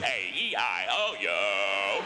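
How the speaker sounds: deep, slow robotic toy voice; Speak and Spell voice. Retold or imitated. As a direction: drone each letter like an evil machine.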